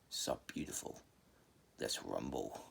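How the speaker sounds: a dark spirit savoring the fight; low demon voice. Retold or imitated. Zephos taking over Naruto, taunting Tsunade in a low demon voice.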